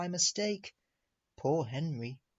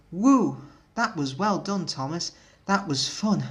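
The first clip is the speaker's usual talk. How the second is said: cheerful praise; 3 semitones higher, 7 dB louder than usual. A narrator's reading. Bertie praising Thomas after race.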